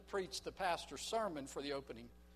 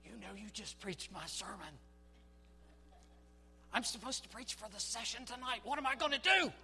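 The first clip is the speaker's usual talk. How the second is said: from hushed worry to frantic alarm; the pastor panics to the young man about tonight; rising panic; 6 semitones higher, 3 dB louder than usual. Acted. Pastor panics after sermon stolen.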